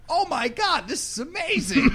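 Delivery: high, laughing delight; delighted gush. Retold or imitated. Mocking the friends' unbothered glee in Walmart, high delighted. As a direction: gush like it's the best thing ever. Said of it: "They're just like".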